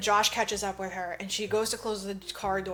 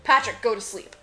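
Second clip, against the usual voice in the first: stern command; 5 dB louder than usual. Retold or imitated. Commanding the bot in story.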